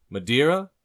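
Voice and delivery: loud, high question that swoops upward; barkeep offering. Acted. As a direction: offer it brightly as a rising question. Barkeep offering, then customer answers.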